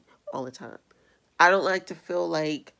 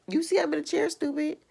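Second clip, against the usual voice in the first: sarcastic retort; 9 semitones higher than usual. Real, not acted.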